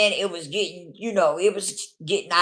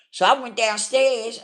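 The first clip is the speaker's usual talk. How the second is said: ordinary talk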